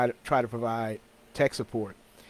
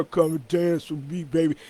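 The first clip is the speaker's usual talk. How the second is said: mock party-ad model; 4 semitones higher than usual. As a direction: lightly parody a flyer model luring people in. Mimics models on party ads.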